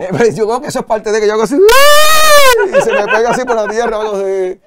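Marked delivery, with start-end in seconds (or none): 1.6-4.0 very high, loud, stretched yelp while laughing; mock electrocuted shout. Describing touching live wires, a laughing shocked yell. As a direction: act out getting zapped through the ground.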